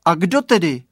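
put-on loud, high child call; boy calling. A narrator's reading. Boy asks fog who cries, loud call.

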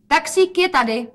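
high, loud announcement; calling out. A narrator's reading. Daughter announces the taxi, calling out. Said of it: "zvolala Rozetka".

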